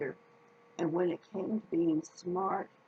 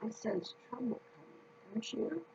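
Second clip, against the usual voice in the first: much softer, ominous hush; suspenseful hush; 3 semitones higher, 8 dB quieter than usual. A narrator's reading. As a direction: lean in and warn of danger. Storyteller builds suspense.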